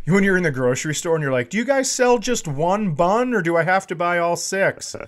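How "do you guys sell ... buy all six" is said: mock shopper. Mock shopper asking clerk.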